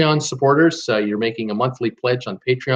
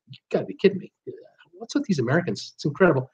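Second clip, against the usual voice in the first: hushed, higher-pitched mock incredulity; imitated disbelief; 5 semitones higher, 6 dB quieter, faster than usual. Other countries looking in at America, softly incredulous. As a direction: play baffled onlookers, softly aghast. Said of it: "every country in the world just looks in and goes".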